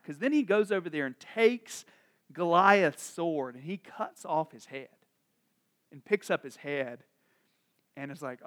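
ordinary talk

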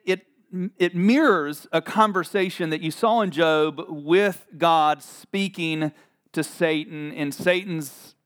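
ordinary delivery

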